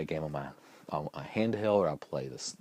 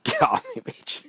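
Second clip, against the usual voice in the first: high-pitched mock scream breaking into laughter; retold scream; 5 dB louder than usual. Husband re-enacting his outburst at wife, laughing scream. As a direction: screech it, cracking up. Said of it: "And I screamed".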